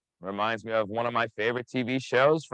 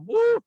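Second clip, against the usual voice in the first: very high, loud whoop; excited woo; 6 dB louder than usual. Real, not acted. Instructor whoops to fire up the punching class.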